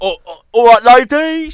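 very loud, high holler to the ladies; mock catcall. Re-enacting the cow catcall for friends, loud mock holler. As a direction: bellow a hammed-up catcall. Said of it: "We go".

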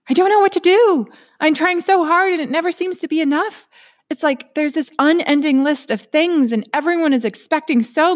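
a frantic overworked inner self pouring it all out; Miss Busybody. Acted. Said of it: "I've nicknamed her Miss Busybody".

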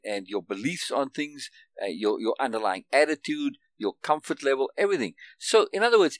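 ordinary delivery

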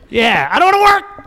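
mock whiny voice. Acting out own lazy voice.

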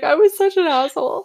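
laughing through the words. Real, not acted. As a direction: confess it between laughs. Laughing confession about past self.

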